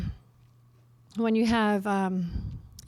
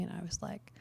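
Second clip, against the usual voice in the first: whispered; 2 semitones lower, 11 dB quieter than usual. Real, not acted. Realization washing over the speaker.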